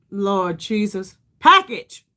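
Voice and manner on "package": sudden loud, high blurt of the word "Package"; real frustrated yell after tripping over "Package". Real, not acted. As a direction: shout "Package" at the viewers, higher and louder. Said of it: "I'm yelling at y'all".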